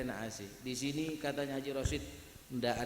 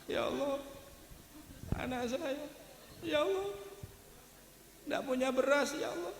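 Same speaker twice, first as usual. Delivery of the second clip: high, wailing mock sob of a pleading prayer; pretend crying prayer; 11 semitones higher, 3 dB quieter than usual. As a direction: fake a tearful plea to God, pitched up. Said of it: "Kalau tidak bisa nangis, pura-pura nah". (doa pura-pura menangis dengan suara tinggi merintih)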